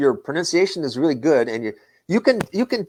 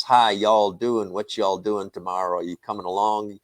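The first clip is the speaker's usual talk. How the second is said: slow, drawling Southern US accent; 4 semitones lower than usual. Shown as a demonstration. Teacher showing students how Georgians sound, slow Southern drawl. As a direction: stretch every vowel the way they talk in Georgia. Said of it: "the accent will change like this".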